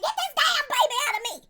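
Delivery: high mock yell of a woman in labor, laughing through it; laboring wife. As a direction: ham up the wife screaming in labor. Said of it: "you hear her up and saying".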